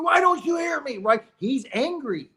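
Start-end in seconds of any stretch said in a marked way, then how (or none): 0.0-1.0 high, pleading yell; high frantic cry to his wife; desperate scream. Retold or imitated. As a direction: shout it, desperate not to be heard. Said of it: "He's screaming".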